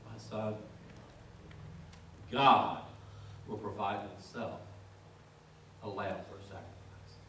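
quiet tender father. Retold or imitated. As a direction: hush down and reassure the boy gently. Abraham answers his son quietly.

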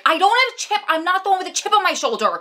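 high, mock-defensive denial. Vlogger playing the one with the chip. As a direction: protest your innocence playfully. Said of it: "that is so me".

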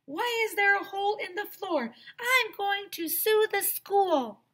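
high furious teacher; mad teacher. A narrator's reading. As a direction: rant from the bottom of the hole. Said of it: "Missus Mentry sounded pretty mad".

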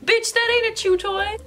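high, sassy dog voice; dog's voice. Retold or imitated. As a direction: voice the dog's sass. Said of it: "He's looking at me like".

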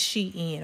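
Shein as two syllables, "SHEE-in"; correct pronunciation. Shown as a demonstration. Repeating the correction for viewers, Shein pronounced "SHEE-in". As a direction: say Shein as "she" followed by "in". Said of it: "That's wrong. It's Shein".

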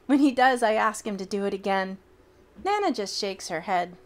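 usual speaking voice

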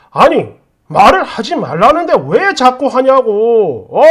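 slow, drawn-out angry demand; fed-up scolding; exasperated husband. Acted. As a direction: stretch the words out in exasperation. Acted husband demanding in quarrel.

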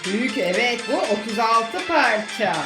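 usual speaking voice

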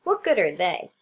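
scornful. A narrator's reading. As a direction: scoff at the idea. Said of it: "snorted the fat mouse".

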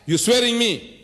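high-pitched, indignant reprimand; stern rebuke. Retold or imitated. Allah answering a grave insult, indignant rebuke. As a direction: voice climbing as you scold. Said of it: "Allah says".

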